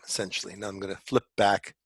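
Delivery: ordinary talk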